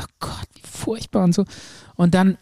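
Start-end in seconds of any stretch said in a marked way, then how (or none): 0.0-1.2 whispered, horrified; horrified thought. Retold or imitated. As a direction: breathe it out in horror. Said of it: "Ich dachte". (entsetztes Flüstern)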